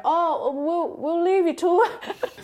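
bow out with a nervous laugh; lightly voiced awkward retreat. Friends on the phone backing off from the brother, awkward laugh. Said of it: "his facial expressions go".

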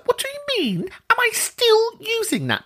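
насмешливое возмущённое недоумение, голос сильно выше; передразнивает собственное изумление высоко и театрально; mock indignant disbelief. Mock reply to rude gym guy.

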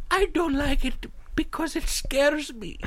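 high, slow, careful little voice; timid, scared lady. Retold or imitated. Timid passenger explaining to the guy, scared and slow. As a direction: imitate a frightened confession, drawn out. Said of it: "it was one of sheer horror".

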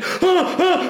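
very high laughing squeal of alarm; mock frightened cry. Nice audience freaked out, squealing half-laughing. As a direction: squeal like a startled guest.

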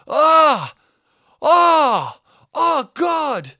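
loud, high cries of shock; retold scream. Neighbour below hit by falling water, high horrified screams. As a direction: scream out as if just drenched. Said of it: "I just heard someone go".

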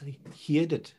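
Welsh "heard" said like "heed"; Welsh accent. Shown as a demonstration. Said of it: "when they say they heard something".

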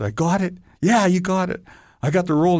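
can't believe your luck, say it bursting; overjoyed actor learning he got the part; excited disbelief. Retold or imitated. Thrilled exchange after the audition.

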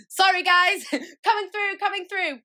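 high, loud sing-song call pushing through; calling out cheerfully to clear the way; cheery shout. Retold or imitated. Mum with pram calling to queue, high loud cheerful shout.

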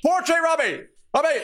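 very high, comic bossy shout; exasperated mock yell. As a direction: yell at your useless assistant in jest. Acted scolding of hapless friend.

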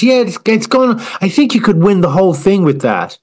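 high, gushing judge voice; mock Louis Walsh. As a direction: gush at the wallpaper like a star judge.

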